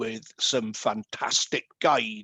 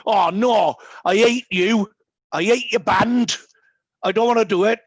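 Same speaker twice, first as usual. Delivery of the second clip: louder, higher mock complaint; dog's whiny protest; 6 semitones higher, 5 dB louder than usual. Guide dog dreads the band's tour bus, mock whining shout. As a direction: voice the dog refusing the tour bus, loud and sulky. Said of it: "he would physically slow down and go".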